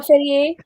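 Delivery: "Ferrier" said the French way, ending "ee-ay"; French-style "Ferrier" with a silent final R; French pronunciation. Shown as a demonstration. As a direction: say "Ferrier" as fair-ee-AY. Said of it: "You have to say it the French way".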